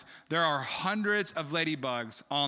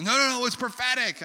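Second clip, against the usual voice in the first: urgent protest; 8 semitones higher, 3 dB louder than usual. Retold or imitated. Acts out Julie objecting once more.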